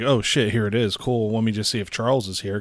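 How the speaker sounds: ordinary talk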